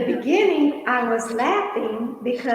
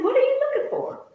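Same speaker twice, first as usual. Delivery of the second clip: mock curious man; 12 semitones higher, faster than usual. Man voiced, high and quick.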